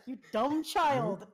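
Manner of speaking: slow, loud scornful sword; talking sword voice. Retold or imitated. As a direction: drag it out with contempt. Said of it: "It's like".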